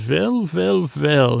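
put-on German doctor accent; fake German accent. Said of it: "in, like, a, a fake German accent".

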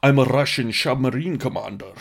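slurred-s mock Scottish accent; put-on Scottish accent, "submarine" with a sh sound; Sean Connery. Host imitating a Russian commander in Hunt for Red October, Scottish accent. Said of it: "hearing Sean Connery say".

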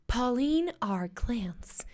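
put-on slow names in an American English accent. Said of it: "con acento gringo".

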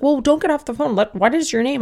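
lightly voiced receptionist, pitched high; a hint of officious receptionist, high-pitched; mock receptionist. Receptionist stopping an angry mother hanging up, lightly mocked.

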